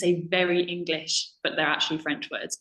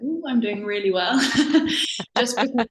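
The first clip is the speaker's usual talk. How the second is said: laughing; 4 semitones higher, 3 dB louder than usual. Retold or imitated. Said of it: "I was like".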